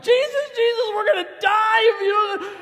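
very high, full-volume panicked scream; hammed-up shriek of terror; acted terror. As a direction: scream for rescue as if drowning. Disciples wake Jesus in terror.

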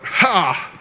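an unclean spirit's outburst; crying-out spirit. Acted. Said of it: "the unclean spirit cries out".

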